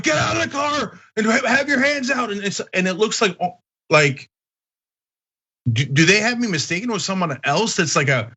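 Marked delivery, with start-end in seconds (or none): 0.0-2.3 shouting cop. Acted. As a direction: imitate an officer yelling orders. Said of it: "And they're like".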